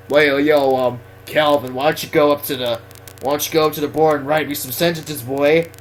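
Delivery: lightly voiced joking teacher; Mister Heel. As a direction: imitate a teacher casually ordering a pupil to the board. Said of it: "he'd always say something funny".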